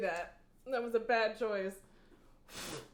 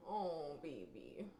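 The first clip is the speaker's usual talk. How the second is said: much softer, lower coo; 7 semitones lower, 11 dB quieter, slower than usual. Real, not acted. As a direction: murmur it to the cat. Cooing to cat on shoulder.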